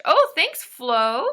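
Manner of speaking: high, loud burst of thanks; light up and thank her; suddenly thrilled shout-out; excited thanks. Real, not acted. Thanking viewer in chat.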